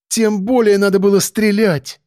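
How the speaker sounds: put-on high, insistent girl's voice; high-pitched decisive retort; resolute young woman. A narrator's reading. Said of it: "решительно притопнула каблучком комсомолка".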